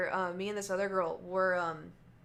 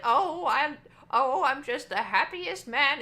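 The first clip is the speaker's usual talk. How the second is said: put-on higher, slow, gushing old-man voice; happy old man; 6 semitones higher, 8 dB louder, slower than usual. Old man telling the waitress he's the happiest man, gushing.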